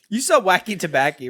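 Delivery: high, laughing put-on voice; buyer voice. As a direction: play a giggly buyer asking the plug. Role-plays buying from dealer.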